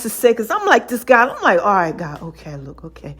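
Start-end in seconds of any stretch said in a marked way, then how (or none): 1.6-3.2 much lower and softer, deflated; resigned giving in. Retold or imitated. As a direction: let it fall away as you give up. Giving in to God's instruction.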